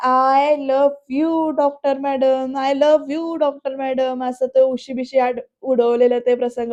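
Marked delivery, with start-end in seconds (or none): 0.1-4.2 a drawn-out, moony I love you; slow dreamy confession. Retold or imitated. Drunk Surya confessing to the doctor madam, slow and dreamy. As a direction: stretch every word out slowly, lovesick.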